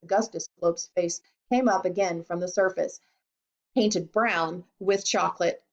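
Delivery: usual speaking voice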